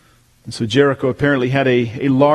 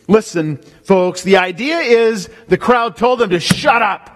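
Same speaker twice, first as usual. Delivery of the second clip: preaching; 7 semitones higher, slower than usual. Acted. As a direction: drive it home from the pulpit. Preacher at full force.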